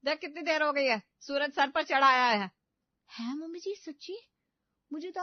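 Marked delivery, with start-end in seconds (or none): 0.0-2.5 scolding. Acted. Mother-in-law scolding again.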